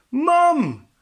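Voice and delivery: very high, loud wailing howl. A narrator's reading. Little Wolf howls for his mum, high and loud. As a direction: howl for your mother. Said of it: "howled Little Wolf".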